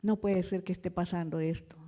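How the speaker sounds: low, hushed, grieving disbelief; subdued, sorrowful murmur; quiet disbelief. Retold or imitated. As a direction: say it softly, as if to yourself. Retells her own grief.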